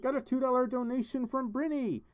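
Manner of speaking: high-pitched, delighted donation call-out; excited announcement. Real, not acted. Streamer announcing a viewer's donation, excited. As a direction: pipe up with sudden excitement.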